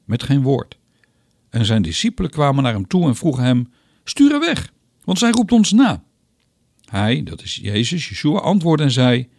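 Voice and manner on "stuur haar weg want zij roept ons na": exasperated demand, pitched much higher; annoyed disciples. Acted. Disciples urge Jesus to send the woman away, exasperated. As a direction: get rid of her, you're fed up.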